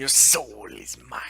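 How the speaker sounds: slow, whispered menacing catchphrase; villain whisper. Retold or imitated. Quoting Shang Tsung to a friend, slow villain whisper. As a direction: hiss it like the game villain.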